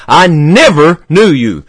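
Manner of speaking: slow line, "never" stretched and lifted; stress on never. Retold or imitated. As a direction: lean long and hard on "never". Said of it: "I emphasize that word never".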